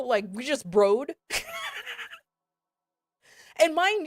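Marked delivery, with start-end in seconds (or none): 1.1-2.1 high, quiet laugh breaking out; burst of laughter. Real, not acted. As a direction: laugh it off at the story. Laughing at own story.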